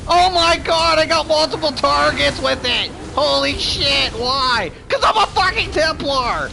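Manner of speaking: high, loud gleeful yelling; thrilled shouts at his own combo; excited shouting. Real, not acted. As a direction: crow over the big play. Multi-target hit while gaming.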